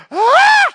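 sudden high shriek of panic, very loud; frightened scream. Acted. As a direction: scream as the boat starts shaking under him. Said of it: "and he went".

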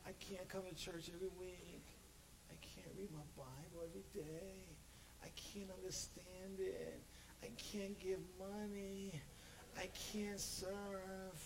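soft high mock complaint; whiny excuses. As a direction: whine like a reluctant churchgoer. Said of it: "excuses of the listener".

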